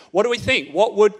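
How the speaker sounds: put-on calling out to the boys to answer. Shown as a demonstration. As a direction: push it out, wanting a reply. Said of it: "this is an actual engagement".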